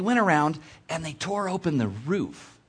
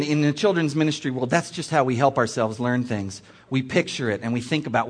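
usual speaking voice

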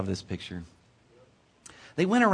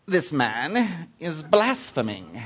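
slow, villainous mock-British accent; wicked British accent; 2 semitones higher, much slower than usual. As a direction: put on a drawn-out British henchman accent. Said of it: "they all speak with that wicked British accent".

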